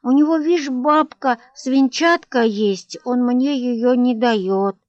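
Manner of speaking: put-on little boy whining; nasal whining child. A narrator's reading. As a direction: whine it like a spoilt kid. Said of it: "отвечал Ваня гнусливо".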